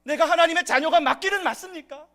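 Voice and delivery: high, loud anguished complaint; complaining to God. Retold or imitated. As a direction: cry out to God in frustration, pleading. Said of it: "하나님께 이런 불평을 할 수 있습니다". (하나님께 높고 크게 부르짖는 불평)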